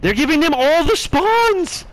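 high, slow frustrated yell. Real, not acted. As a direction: shout it out in disbelief. Frustrated gamer yelling callout.

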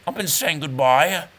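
usual speaking voice

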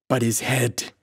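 a touch of hysterical protest, lightly voiced. A narrator's reading. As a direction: protest with rising panic, understated. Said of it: "the mate protested hysterically".

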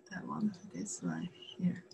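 whispered aside to herself; breathy whisper while pointing. Real, not acted. Teacher pointing at board.

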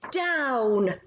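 witch calling out a command with a falling tune; a mild storybook cry; cried out. A narrator's reading. Witch to her riders on the broom, crying out. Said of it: "cried the witch".

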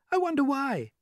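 high-pitched ladybird voice; ladybird character. Acted. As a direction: voice a wondering ladybird, pitched well up. Ladybird speaks in story.

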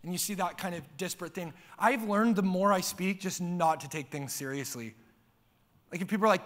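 usual speaking voice

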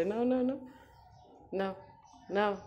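high sing-song offer to a pet; sing-song pet talk. Real, not acted. Owner offering Koko the toy, high sing-song pet voice. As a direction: coo the offer upward.

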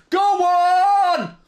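loud high cheer; celebratory shout. Real, not acted. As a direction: yell it out in joy. Celebrating goal.

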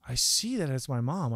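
usual speaking voice

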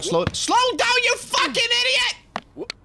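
high angry scream at the character; furious yell. Real, not acted. Gamer raging at his own game character, furious yell. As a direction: lose your temper fully.

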